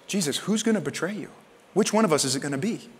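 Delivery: soft, confidential asking; hushed question. Retold or imitated. John leaning on Jesus to ask, hushed question. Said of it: "John looks up at him and goes".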